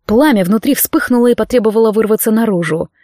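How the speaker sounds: usual speaking voice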